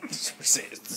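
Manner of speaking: hushed, laughing anime whisper; mock awed whisper. Player teasing the game master's anime power-up, mock awed whisper. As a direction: whisper it in playful mock awe.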